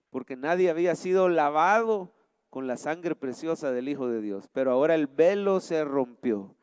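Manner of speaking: low, softened praying voice; prayer. Real, not acted. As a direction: thank God quietly and reverently. Leads the congregation in prayer. (oración en voz baja y grave)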